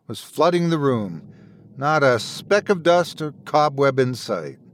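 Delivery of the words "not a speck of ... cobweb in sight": ordinary delivery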